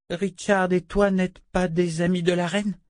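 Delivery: put-on high child's questioning voice; little girl. A narrator's reading. Girl checks whose side they are on, high child voice.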